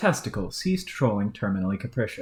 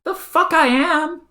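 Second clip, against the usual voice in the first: higher, louder, slow outraged retort; indignant outburst; 8 dB louder than usual. Acted. Troll snapping back at his partner, loud and outraged. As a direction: explode at the insult.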